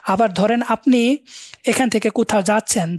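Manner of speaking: ordinary talk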